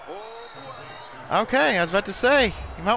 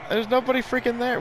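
excited; 7 semitones higher, faster than usual. Real, not acted. Big run by his player.

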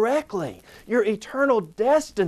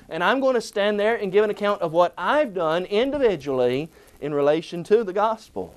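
ordinary talk, nothing marked

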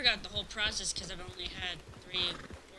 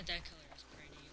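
whispering; 5 semitones lower, 9 dB quieter, faster than usual. Real, not acted. Admiring horse quietly.

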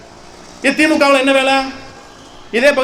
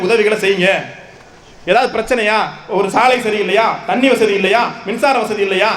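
usual speaking voice

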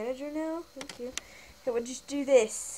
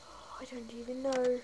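ordinary talk

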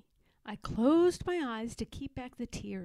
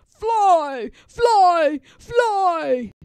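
higher, very loud troll chant; shouting trolls; 8 semitones higher, 16 dB louder, much slower than usual. Acted. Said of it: "they shouted at me".

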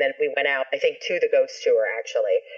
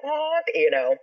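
honking voice; 9 semitones higher, slower than usual. Retold or imitated. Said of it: "the very first cars had the honk".

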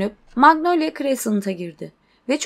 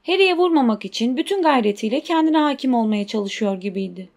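plain reading voice

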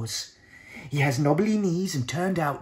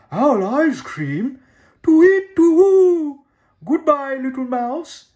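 much higher and louder, hooting in fright; panicked owl; 12 semitones higher, 13 dB louder, slower than usual. Acted. Frightened owl flees the mouse, hooting loudly.

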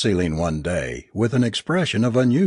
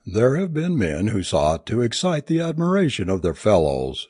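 ordinary delivery

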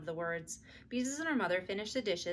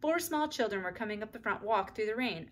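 usual speaking voice

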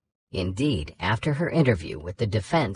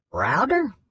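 higher, drawn-out southern judge calling her name; southern drawl; 9 semitones higher than usual. Retold or imitated. Judge calling the lawyer's name, southern drawl. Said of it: "doing her best impression of Dupree's southern drawl".

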